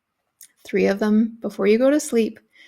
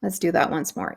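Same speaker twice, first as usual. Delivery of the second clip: whisper; 2 semitones lower, 3 dB quieter than usual. Real, not acted. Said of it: "whispered cue".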